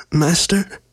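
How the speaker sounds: high, husky and unsteady character voice; raspy, a little shaken. A narrator's reading. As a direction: croak it out, mildly shaken. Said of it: "sounded raspy and a little shaken".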